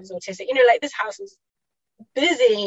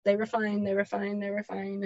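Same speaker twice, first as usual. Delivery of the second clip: each repeat softer, fading away; trailing off softer each time; fading repetition; 3 semitones lower, 7 dB quieter than usual. Real, not acted. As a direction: let it die out word by word.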